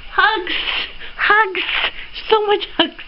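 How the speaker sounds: high, bursting exclamations; giddy, grateful outburst; gleeful affection. Real, not acted. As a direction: send excited hugs in a high voice. Sending hugs to gift giver.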